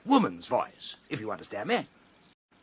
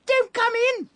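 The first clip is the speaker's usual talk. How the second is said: shrill, nervous squeak, much higher; high-pitched nervous squeak; 5 dB louder than usual. Retold or imitated. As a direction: squeak it out in a panicky falsetto. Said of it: "High-pitched, you know, and nervous".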